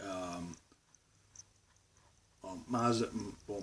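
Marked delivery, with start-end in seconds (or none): none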